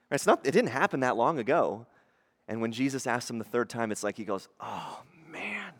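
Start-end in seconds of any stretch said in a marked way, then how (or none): 4.6-5.7 breathy, whispered groan of dawning dismay; dismayed realization. Acted. Peter to himself when asked the third time, hushed dismay. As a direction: whisper it as the memory hits.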